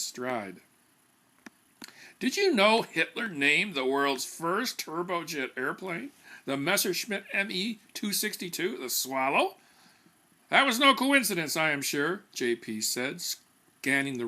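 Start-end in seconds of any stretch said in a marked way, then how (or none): 2.2-12.2 lightly voiced know-it-all, a touch higher; self-satisfied consultant lecturing the room; pompous PR man. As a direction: hold forth like a smug expert. JP holds forth to committee.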